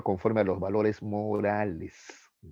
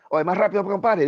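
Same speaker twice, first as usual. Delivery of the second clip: much higher and louder bellow; big man's demand; 10 semitones higher, 6 dB louder than usual. Retold or imitated. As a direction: boom it out like a huge man banging on the bus. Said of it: "Y lo mismo".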